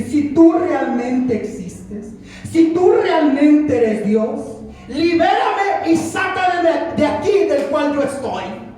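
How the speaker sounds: high, loud, drawn-out impassioned cry; full-force preaching. Acted. Preacher reliving his desperate plea to God before the congregation, full-force preaching. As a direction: preach it at the top of your voice, stretching the words.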